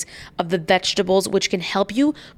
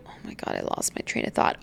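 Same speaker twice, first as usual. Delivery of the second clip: whispering; 10 dB quieter than usual. Real, not acted. Forgets what she was saying.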